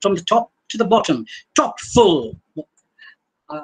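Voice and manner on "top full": "top full" as two heavy, equal beats; both syllables stressed. Shown as a demonstration. Lecturer demonstrating a spondee, "top full" stressed on both words. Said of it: "stressed both the syllables".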